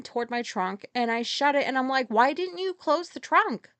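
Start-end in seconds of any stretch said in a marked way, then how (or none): 2.1-3.7 higher, mildly louder retold yell. Re-enacting calling out to the grocery worker, a mild yell. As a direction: call it out to her across the lot. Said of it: "I just yelled that out of her".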